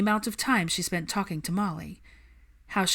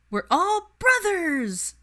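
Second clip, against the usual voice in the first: very high, loud, slow cheer; triumphant child's declaration; 13 semitones higher, 5 dB louder, much slower than usual. Acted. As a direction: proclaim it with a fist in the air. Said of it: "Al declared with a victorious fist".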